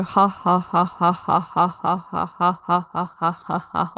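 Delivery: even, monotone string of ha-has; forced laughter drill. Shown as a demonstration. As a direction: force out evenly spaced fake laughs. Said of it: "Just sit there and go".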